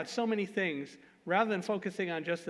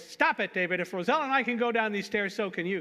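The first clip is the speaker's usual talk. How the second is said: sharp shout, much higher and louder, at a panicking colleague; loudest, sharpest voice; 4 semitones higher, 5 dB louder than usual. Retold or imitated. Man retelling how he yelled at a panicking colleague on the stairs, sharp shout. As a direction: snap him out of it with a commanding yell. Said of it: "in my loudest, sharpest voice".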